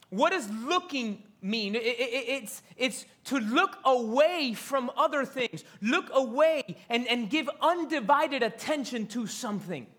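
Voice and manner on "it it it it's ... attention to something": ordinary talk, nothing marked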